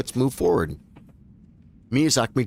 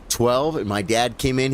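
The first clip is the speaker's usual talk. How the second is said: usual speaking voice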